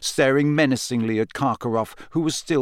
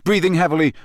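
usual speaking voice

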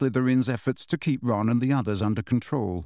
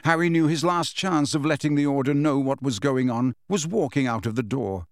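plain reading voice

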